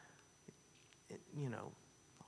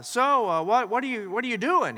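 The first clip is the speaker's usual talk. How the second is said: higher, louder taunting question; a smug student poking fun; mocking college boy; 7 semitones higher, 7 dB louder than usual. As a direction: jeer at the old farmer. College boy mocks praying farmer.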